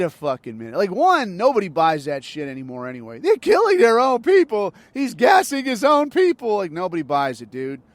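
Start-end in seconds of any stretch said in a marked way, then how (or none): 3.2-6.6 mock shrill outrage. Parodying the pundits' talking points.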